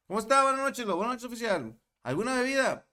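high, formal officer questioning; police checkpoint voice. Retold or imitated. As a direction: act out the checkpoint greeting. Retold police checkpoint exchange.

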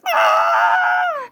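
very high-pitched shriek of horror; shrill squeal of revulsion; disgusted squeal. Real, not acted. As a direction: let out a laughing, grossed-out scream. Disgusted at the imagined line.

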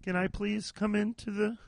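a soft, timid plea at the door; timid asking. Retold or imitated. Speaker as a vampire asking to enter Chipotle, timid and soft. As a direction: ask permission like a shy vampire. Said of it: "I'm like".

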